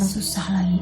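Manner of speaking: soft breathy whisper; quiet unvoiced sigh of pity. Real, not acted. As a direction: murmur in sympathy. Pitying sister's suffering.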